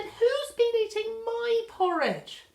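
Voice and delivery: a high, fussy mother bear asking; Mummy Bear. Acted. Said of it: "I said".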